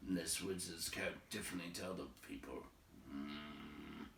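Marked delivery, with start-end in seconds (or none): none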